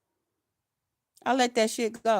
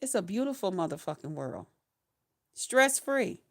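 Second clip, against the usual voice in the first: calm contented; 5 semitones lower, 8 dB quieter than usual. Real, not acted. Telling viewers retirement is beautiful, soft contented. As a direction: lower and softer, relaxed and content.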